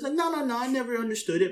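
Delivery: taxi passenger's denial. Retold or imitated. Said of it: "at first he was like".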